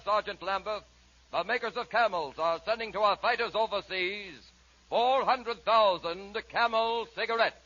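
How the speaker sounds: normal talking voice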